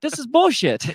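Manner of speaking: high, exasperated complaint; indignant outburst. Retold or imitated. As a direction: complain like a fed-up extremist. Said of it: "It's like".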